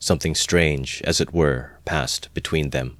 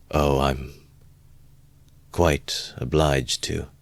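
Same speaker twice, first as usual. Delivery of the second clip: slow, halting answer; vague, hesitant; 3 dB quieter, much slower than usual. A narrator's reading. Brother answering his family's question, vague and hesitant. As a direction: draw it out, unsure what to say. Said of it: "answered vaguely, as though hesitating".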